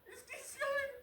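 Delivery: high shrieks with laughter; shrieking jackpot winner. Real, not acted. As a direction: squeal as if you won big. Players falling into his trap.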